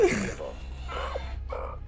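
airy, voiceless laugh; breathy laugh. Real, not acted. Laughing after the round.

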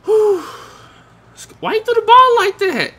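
real incredulous outburst; exasperated. Real, not acted. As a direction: squeal the question out in disbelief. Exasperated at a bad pass.

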